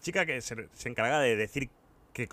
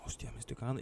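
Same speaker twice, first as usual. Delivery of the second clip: unvoiced whisper, held in; whispered to himself; 3 semitones lower, 16 dB quieter than usual. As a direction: breathe it out so nobody hears. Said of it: "Y yo callado, en plan". (susurrado para sí mismo, aguantándose)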